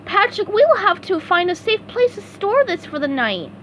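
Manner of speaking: very high, eager cartoon sponge; bright squeaky helper ready to go; eager SpongeBob voice. A narrator's reading. SpongeBob volunteering to his boss, high eager voice.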